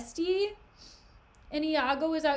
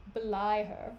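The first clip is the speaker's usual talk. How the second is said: normal talking voice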